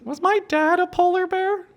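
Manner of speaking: very high, slow little cub voice; baby polar bear. Acted. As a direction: ask it like a curious tiny cub. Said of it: "the baby polar bear looks at the mom and goes".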